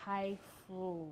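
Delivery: ordinary talk, nothing marked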